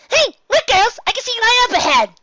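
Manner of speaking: very high, loud joyful shout; shrill-high, loud and thrilled; excited shout. Acted. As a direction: spot the light and yell with glee. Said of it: "Pinkie shouted".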